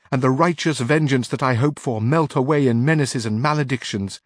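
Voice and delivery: wrathful command. Acted. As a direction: drive the maid out in a fury. Camilla in her wrath.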